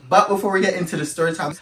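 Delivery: usual speaking voice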